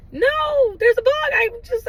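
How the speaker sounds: high, loud re-enacted upset; hammed-up frantic complaint; distraught protest. Re-enacting upset answer to security officer.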